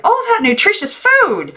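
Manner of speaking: very high, loud lament; dismayed aunt. A narrator's reading. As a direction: wail over wasted food.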